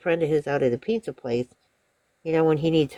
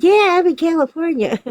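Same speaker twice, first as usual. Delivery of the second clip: high, louder insistent mimicry; imitated indignant customer; 11 semitones higher, 5 dB louder than usual. Customer confirms her state to pizza caller, emphatically.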